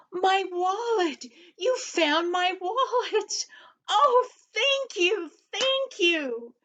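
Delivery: high, slow cries of joy, laughing and near tears; a little old lady beside herself with relief; overjoyed. Acted. Lady gets her lost wallet back.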